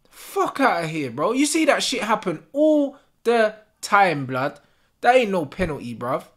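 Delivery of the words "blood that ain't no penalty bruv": usual speaking voice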